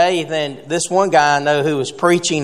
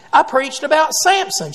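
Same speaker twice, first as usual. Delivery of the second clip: higher, defensive protest about Samson; mock indignant insistence; 8 semitones higher than usual. Husband protesting to his wife, mildly indignant.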